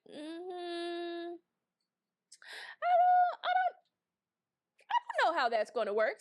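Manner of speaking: squeaky high hesitant I don't, then a quick brush-off; doubtful Mercury. Acted. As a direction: play Mercury as a reluctant skeptic squeaking in disbelief. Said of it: "Mercury's like".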